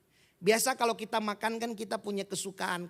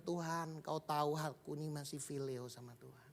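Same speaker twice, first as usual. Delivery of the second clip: quiet sorrow; 7 semitones lower, 11 dB quieter than usual. Acted. Peter's third, broken reply.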